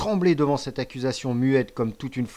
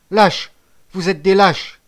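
high, loud, drawn-out insult; defiant retort; 8 semitones higher, 12 dB louder, slower than usual. A narrator's reading. Cornered boy insulting his accusers, defiant shout. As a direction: fling it back at them, slowly and loudly. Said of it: "riposta Bacayé".

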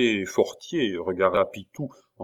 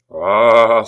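exasperated cry; 12 dB louder than usual. A narrator's reading. Abbé losing patience, crying out. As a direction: explode in anger. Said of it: "s'écria l'abbé".

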